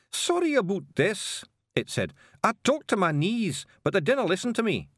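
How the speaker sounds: sheepish wee man in a coat; Feegle in disguise. Acted. Apology to carter.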